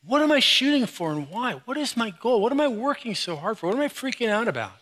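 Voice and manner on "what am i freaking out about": ordinary delivery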